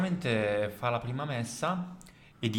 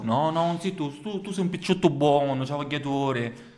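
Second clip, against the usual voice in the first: put-on Sicilian accent; 4 semitones higher than usual.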